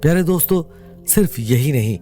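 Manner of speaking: usual speaking voice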